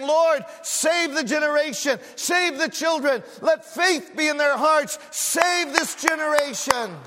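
high, loud fervent prayer; impassioned pulpit cry; crying out to God. Acted. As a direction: shout the plea to God, drawing out the last. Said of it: "crying out to God".

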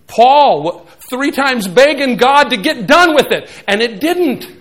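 loud, high preaching at full force. Acted. Pastor preaching to the congregation, at full force.

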